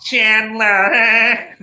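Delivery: put-on high, annoying sitcom-girlfriend call; Janice. Mimicking a Friends character for laughs, high annoying voice. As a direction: draw the name out in a high caricature. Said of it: "her voice is so annoying".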